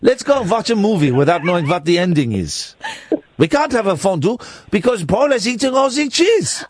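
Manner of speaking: mock-mournful Swiss accent; hammed-up Swiss villager's accent. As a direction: lament in a comic Swiss accent. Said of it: "It's a Swiss accent".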